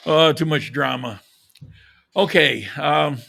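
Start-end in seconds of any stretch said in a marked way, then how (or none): none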